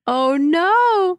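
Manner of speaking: high, drawn-out dismay; dismayed reaction. Real, not acted. Co-host hearing what the line sounds like, dismayed. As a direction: let the oh-no swoop up high.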